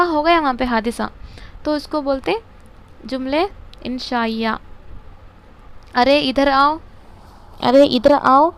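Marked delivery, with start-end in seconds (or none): 5.9-8.5 loud call to come over, said twice. Shown as a demonstration. Teacher calls a student over as an example, loud. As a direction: call someone over from across the room. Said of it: "ये इंशाइया है".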